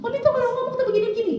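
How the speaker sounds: tinggi dan cepat, meniru ibu yang mengomel; anak meniru omelan. Retold or imitated. (anak menirukan omelan ibunya dengan cepat)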